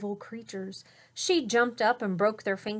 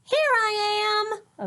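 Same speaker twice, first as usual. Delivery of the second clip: sister appearing; 12 semitones higher, 6 dB louder, slower than usual. Acted.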